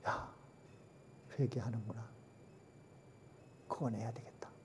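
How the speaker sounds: God quietly deciding to save the city; God speaking softly. Acted. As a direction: murmur it to yourself, then whisper. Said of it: "하나님은 그걸 보셨어요".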